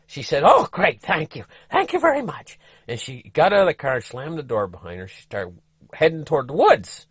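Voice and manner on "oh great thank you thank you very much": high, relieved wife voice; wife thanking, pitched up; grateful wife. Retold or imitated. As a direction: thank him in a rush.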